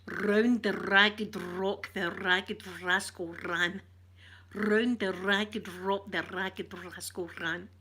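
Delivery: slow, deliberate rolled-r tongue twister. Shown as a demonstration. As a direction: roll every R in Round, ragged, rock, rascal, ran. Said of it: "we got them to say".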